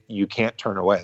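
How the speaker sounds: ordinary talk, nothing marked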